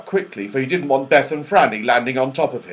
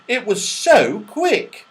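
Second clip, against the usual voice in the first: higher, slow delighted voice; Beth delighted; 8 semitones higher, 4 dB louder, slower than usual. Acted. Beth thrilled about the slide, higher and slow. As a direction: marvel at the ride. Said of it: "said Beth".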